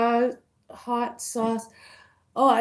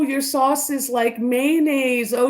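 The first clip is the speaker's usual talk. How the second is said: ordinary talk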